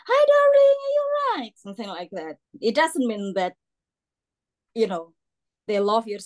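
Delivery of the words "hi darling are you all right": very high, loud cooing darling; old person greeting a stranger; imitating old Londoner. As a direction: coo it like an elderly local. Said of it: "like old people".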